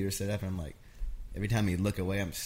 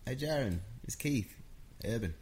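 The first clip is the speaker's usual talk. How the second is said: a light mock Australian accent, a bit higher; 4 semitones higher than usual. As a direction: greet him lightly in a put-on Australian accent. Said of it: "That's my Australian accent".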